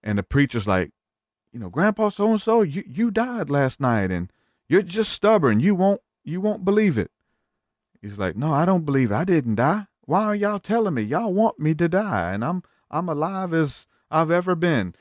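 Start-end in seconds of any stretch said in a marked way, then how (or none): 1.7-7.1 much higher, chiding preacher voice; hammed-up preacher scolding. 8.4-14.9 put-on higher, indignant old man voice; lightly voiced cranky grandpa; stubborn grandpa. Grandpa refuses to believe.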